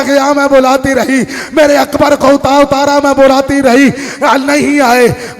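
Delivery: impassioned majlis recitation, slowing on the laments; mourning preaching. Acted. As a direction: drive the grief home to the mourners. Mourning recitation at full force. (मजलिस में दर्द भरा पुरज़ोर बयान)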